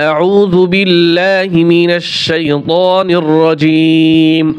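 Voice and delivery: slow, drawn-out tajweed chant; Quran recitation. Shown as a demonstration. Teacher starting the lesson for viewers, slow melodic recitation. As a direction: recite it melodically, stretching the vowels.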